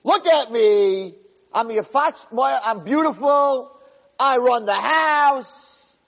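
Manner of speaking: a haughty servant showing off to his master's wife; arrogant boasting. Retold or imitated. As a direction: puff yourself up and crow to her. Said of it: "He's telling Potiphar's wife".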